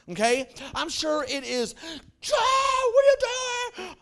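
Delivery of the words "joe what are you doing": high, slow screaming cry. Retold or imitated. Joseph screaming up at his brothers, high and slow. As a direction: scream it up out of the pit. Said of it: "I'm sure he's screaming".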